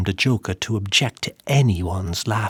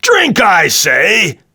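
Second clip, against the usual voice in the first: loud, high, slow command; shout; 7 semitones higher, 12 dB louder, slower than usual. A narrator's reading. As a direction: bellow the order. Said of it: "shouted the monster".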